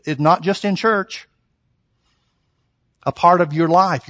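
usual speaking voice

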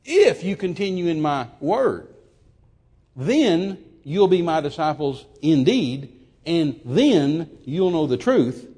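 slow, emphatic, solemn scripture quote; quoting Jesus emphatically. Retold or imitated. Preacher quoting Jesus to the congregation, slow and emphatic. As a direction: lean hard into each then, slow and weighty. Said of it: "He said".

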